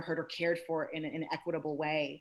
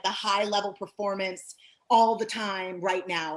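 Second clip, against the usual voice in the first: ordinary talk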